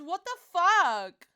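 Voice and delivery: exasperated. Real, not acted. Frustrated at bad luck in bingo.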